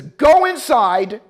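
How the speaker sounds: loud, slow command; barked order. Retold or imitated. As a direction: order them inside. Sergeant ordering them.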